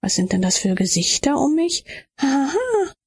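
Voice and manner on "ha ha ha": hohes, fremd klingendes Lachen; lachen, obwohl sie es nicht will; hysterisches Lachen. Acted. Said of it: "Ich will nicht lachen". (hohes, unfreiwilliges Lachen)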